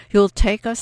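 high, loud rush of hope; eager surprise. A narrator's reading. Woman asks the guide if he'll really take them, eager. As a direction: jump at the offer, thrilled.

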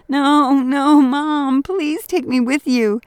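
high, loud desperate wail; crying child. A narrator's reading. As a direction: wail and beg not to be left. Terrified boy begs mother.

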